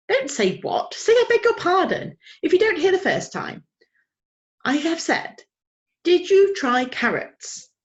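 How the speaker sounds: a genteel lady correcting manners; fussy old lady. A narrator's reading. Old lady lecturing Plop about carrots, high and prim.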